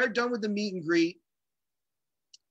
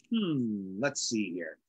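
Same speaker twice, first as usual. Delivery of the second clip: low, softer thinking aloud; musing to himself; 8 semitones lower, 5 dB quieter, much slower than usual. Retold or imitated. Drummer retelling his wheels starting to turn, musing. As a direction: ponder it in a low murmur to yourself.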